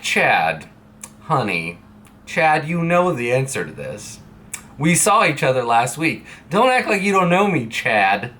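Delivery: lightly acted exasperated chiding, rising on the complaint; playfully fed-up reproach; mock exasperation. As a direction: gently scold someone who should know better. Acting out exasperation at familiar border officer.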